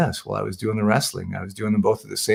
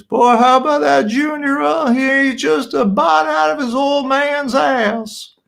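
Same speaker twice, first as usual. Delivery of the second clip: high, slow, gruff Texan drawl; rough old Texas wrestler voice; Terry Funk impression; 14 semitones higher, 4 dB louder, slower than usual. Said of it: "and he goes".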